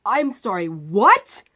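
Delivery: loud, sharp, incredulous; loud disbelief. Retold or imitated. Loud disbelief at the surgeon who says tomorrow. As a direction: demand it loudly, shocked.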